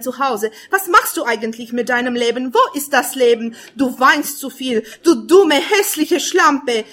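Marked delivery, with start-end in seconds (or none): 5.0-6.8 loud, then each word dragged out slow; louder bitter outburst, slowing on the last words; furious self-insult. Acted. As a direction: spit the insult at yourself. Self-insult bursts out in poem.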